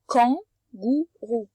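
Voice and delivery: kangourou said very slowly, every sound apart. Shown as a demonstration. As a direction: stretch kangourou so the K is heard. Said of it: "mais avec le K". (kangourou, très lentement, en détachant les sons)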